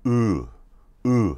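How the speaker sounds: Uh as a tight, closed "ü"-like sound; unfamiliar vowel demo. Shown as a demonstration. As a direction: hold uh tight, not an open "uh". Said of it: "It goes like this".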